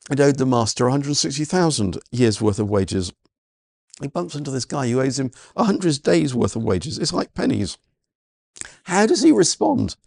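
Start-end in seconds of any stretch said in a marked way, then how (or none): none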